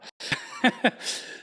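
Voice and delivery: real airy burst of laughter, pitched high; breathy high laugh. Real, not acted. Coach laughing at his own robot joke, high and breathless. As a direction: let a high unvoiced laugh escape.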